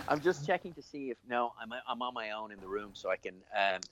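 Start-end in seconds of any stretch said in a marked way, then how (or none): none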